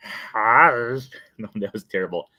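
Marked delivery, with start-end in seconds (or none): 0.1-1.0 louder Stitch hi in a changed voice; Stitch impression. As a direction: try Stitch's hi. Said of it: "this impersonation".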